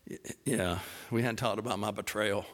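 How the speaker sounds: low, hesitant, deflated realization; sheepish dread. Acted. Peter wading ashore remembers his betrayal, sheepish dread. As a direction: let it sink with a reluctant stammer. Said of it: "he maybe slows down a little bit".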